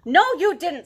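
put-on indignant child's comeback; snapped retort. A narrator's reading. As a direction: cut back sharply as a cross little boy. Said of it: "snapped Sam".

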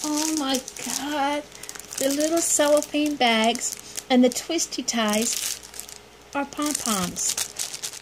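usual speaking voice

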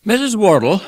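high, loud, genial gentleman's call; Pickwick addressing guest. A narrator's reading. Pickwick addresses old lady at wedding table, high genteel call.

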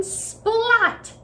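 high, bright splat like paint hitting; splat sound. A narrator's reading. Paint splashing on the roof, a high splat. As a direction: pop the sound effect out.

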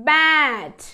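"బ్యాట్" loud, long vowel, falling; pronunciation demo. Shown as a demonstration. Teacher modelling the blended word, "బ్యాట్" loud and drawn out. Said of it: "ఎలా పలుకుతుందో చూద్దాము".

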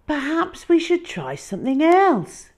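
high, loud helpful suggestion; suggesting boy. Acted. Hal proposing another game, high and bright. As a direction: offer a new idea brightly. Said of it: "Hal suggested".

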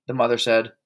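ordinary delivery

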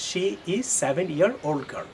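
slow model sentence. Shown as a demonstration. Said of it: "তাহলে আপনি এজটা বলবেন না".

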